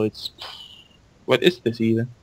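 usual speaking voice

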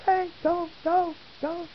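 high excited cheer of go, go. Retold or imitated. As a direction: urge her on from behind.

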